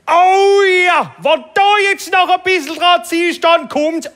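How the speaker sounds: very high, loud hammed-up yelling; man yelling in pleasure. Palatinate man yells from the massage cabin, very high and loud. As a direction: holler it from the massage booth, over the top. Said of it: "hörst du so einen Pfälzer aus einer Kabine schreien".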